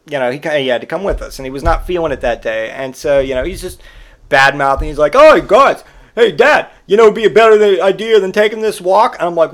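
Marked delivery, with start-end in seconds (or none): 5.1-9.1 higher and louder, whiny put-on kid; bratty kid voice. Son bad-mouthing his dad on a hot walk, higher and whiny. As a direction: mimic a sulky son mouthing off.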